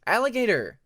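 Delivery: high, loud happy call of a name; excited greeting. Acted. Peacemaker happily calling out to his clawmate across the library. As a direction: call out to a friend with delight.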